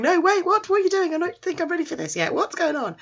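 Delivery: high, squeaky mock alarm; flustered panic. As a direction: babble in hammed-up panic. Flustered boy panics at advances.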